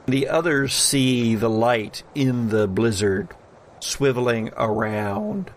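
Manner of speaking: normal talking voice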